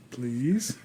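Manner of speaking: soft, drawn-out mock plea; hushed questioning rise, deadpan; creepy deadpan. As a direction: imitate an eerie child pleading quietly. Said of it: "that slow, deadpan voice".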